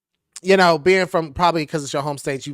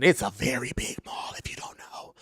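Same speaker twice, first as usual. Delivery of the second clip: whispered aside; 5 semitones lower, 10 dB quieter than usual. Real, not acted. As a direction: drop into an unvoiced whisper. Hushed aside to viewers.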